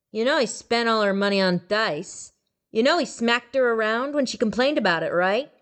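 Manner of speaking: gloating ghoul; accusing ghoul. A narrator's reading. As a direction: rub the god's nose in it. Ghoul accuses the dead man.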